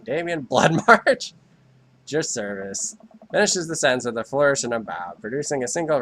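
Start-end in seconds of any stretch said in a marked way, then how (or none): 0.0-3.0 slow, drawn-out grand name; grand introduction. Acted. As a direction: announce the name with a flourish. Grand self-introduction with a bow.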